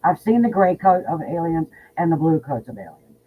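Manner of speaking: ordinary talk, nothing marked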